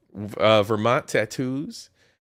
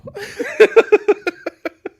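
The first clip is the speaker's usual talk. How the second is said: high, loud burst of laughter; helpless high-pitched cackling laugh; 8 dB louder than usual. Real, not acted. Cracking up at own search-term joke, laughing.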